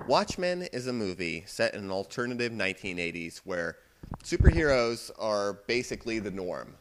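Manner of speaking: ordinary delivery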